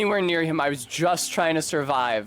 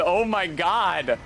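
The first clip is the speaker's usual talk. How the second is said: surprised dismay; 5 semitones higher, slower than usual. Real, not acted. Sees many enemies.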